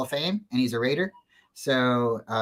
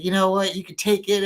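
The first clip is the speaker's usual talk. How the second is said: defiant retort; 6 semitones higher than usual. Retold or imitated. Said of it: "But I was mad".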